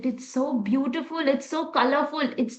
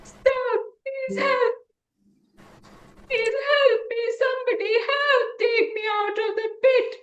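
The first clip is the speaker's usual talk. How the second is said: very high, tiny pleading voice; far-off child sobbing for help; sobbing calf; 12 semitones higher than usual. A narrator's reading. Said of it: "he listens some sob".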